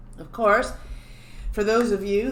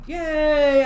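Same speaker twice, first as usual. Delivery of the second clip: happy cheer; 7 semitones higher, 7 dB louder than usual. Real, not acted. Bot finally works.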